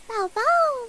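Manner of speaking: imitating a tiny cute dog girl; cute VTuber voice. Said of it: "They're like".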